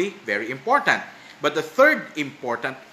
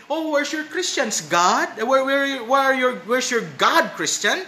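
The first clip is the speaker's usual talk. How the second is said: higher-pitched taunting, imitating scoffers; imitated jeer, pitched up; mock chiding; 7 semitones higher than usual. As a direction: needle them with mocking, sing-out questions. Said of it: "They are chiding the Christians".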